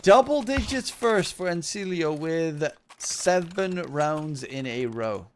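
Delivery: punchy scoreline call, falling to finish; hyped announcement. Pretend. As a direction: announce the streak big, stretching the last words. Caster announces score, then repeats it.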